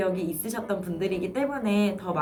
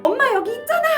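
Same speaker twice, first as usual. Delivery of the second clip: much higher, loud exasperated yell; angry mom; 7 dB louder, much faster than usual. Retold or imitated. Mother yells at her baby who keeps calling for her, angry. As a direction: shout it, fed up. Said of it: "우리가 또 화를 내기도 하죠".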